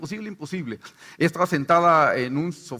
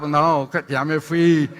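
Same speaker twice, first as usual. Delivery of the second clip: usual speaking voice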